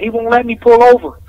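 retold driver hollering for help. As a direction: yell it out so the cops hear. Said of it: "I start hollering".

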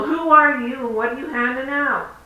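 confronting from the car window; demanding shout. Retold or imitated. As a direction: yell the challenge at the stranger.

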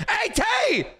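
high excited call. Real, not acted. Streamer spots [name] and calls out high. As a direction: call out to her across the room.

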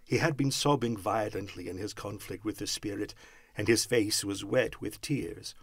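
usual speaking voice

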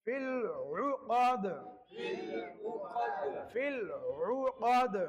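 slow, drawn-out tajweed recitation; teacher chanting Surah Falaq; Quranic recitation. Shown as a demonstration. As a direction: recite the verse slowly for students.

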